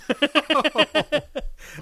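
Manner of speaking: high, loud laughing; burst of laughter. Real, not acted. Podcaster amused by the comic's silly premise, laughing. Laughing at the bastard children line.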